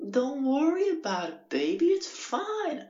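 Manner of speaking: very high, drawn-out falsetto impression; hammed-up high-pitched neighbour; reassuring neighbours. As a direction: squeal a slow, soothing reassurance in falsetto. Voices the forgiving neighbours.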